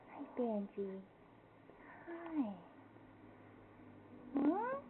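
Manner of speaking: normal talking voice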